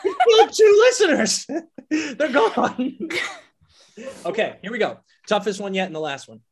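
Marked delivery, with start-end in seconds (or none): 0.0-3.0 loud laughing exclamation; high, loud, laughing outburst. Real, not acted. Laughing about listeners crashing.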